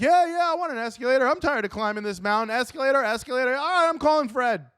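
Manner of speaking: high, fast, gleeful chanting; cheering villagers. Acted. Villagers demanding escalators, high and gleeful chant. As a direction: chant it like an eager crowd. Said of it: "And everybody's like".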